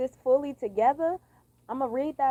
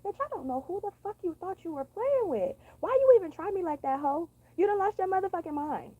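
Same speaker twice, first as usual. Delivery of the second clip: cuss them out in a high voice; high-pitched, put-on telling-off; 8 semitones higher than usual. Shown as a demonstration. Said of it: "in this voice right here and cussing bitches".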